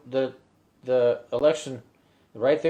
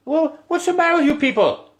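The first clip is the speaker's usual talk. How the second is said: higher, louder Irish pubgoer, incredulous; baffled Irish local; 13 semitones higher, 5 dB louder than usual. Retold or imitated. Dublin bar regular confronting an American tourist, incredulous and louder. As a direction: demand it like a puzzled Dublin regular.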